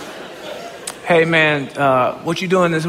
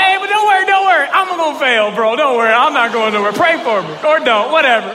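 mock reassurance; 7 semitones higher, 3 dB louder than usual. Teasing his pastor about failing.

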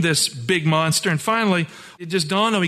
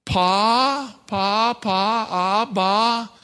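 put-on Pa, pa, pa and abba babbled higher like a toddler; baby babble; 4 semitones higher, much slower than usual. Pastor recalling his little boy's first words, light baby babble. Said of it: "My little boy".

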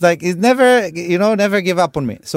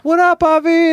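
high-pitched "what up" greeting; acting out a comic's hello; imitated friendly hail; 13 semitones higher, 3 dB louder, slower than usual. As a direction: call out high to a homie. Imitating another comic greeting Avi.

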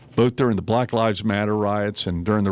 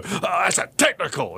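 a higher, sharp mock yell; mock yell at the coach; 6 semitones higher than usual. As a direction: throw it in his face, half-acted. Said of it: "and yell back at him".

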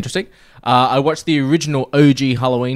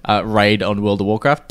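ordinary delivery

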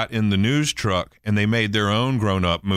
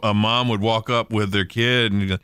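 ordinary talk

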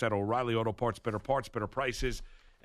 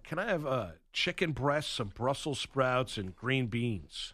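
slow, drawn-out order; slow mock order; 3 dB quieter, slower than usual. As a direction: spell out the order slowly. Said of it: "as he asked, is asked Chef Mike".

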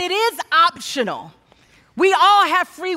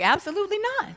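high-pitched, softer mock-incredulous refusal; firm refusal; 6 semitones higher, 6 dB quieter, faster than usual. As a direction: act out turning him down, pitched up. Mother refuses the father's cash offer.

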